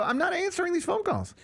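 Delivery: a fed-up person done with callers. Retold or imitated. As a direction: throw up your hands in mock exasperation. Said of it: "At what point do you go".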